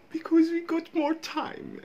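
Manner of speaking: slow, high-pitched mock Devon voice; mimicking slow accent. Vlogger echoing the comedian's Devon line, slow high mimicry. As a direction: drag it out high, parroting the comedian.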